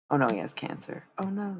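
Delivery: ordinary talk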